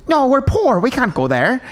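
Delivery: high-pitched imitated objection; mock protest. Imitating Amy refusing the sushi place, mock protest.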